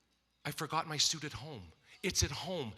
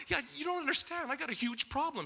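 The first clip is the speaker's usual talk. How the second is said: frantic reply; 10 semitones higher than usual. Retold or imitated. Stressed retort to woman.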